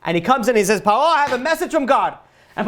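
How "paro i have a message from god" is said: higher-pitched call, lightly acted; announcing messenger. Moses interrupting Pharaoh with a message from God, lightly acted announcement. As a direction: call it out like a herald with news.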